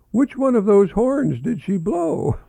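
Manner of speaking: put-on high, slow, wide-eyed child's question; curious child. Marvin asks the farmer, high and wide-eyed. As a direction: ask it as an innocent little boy.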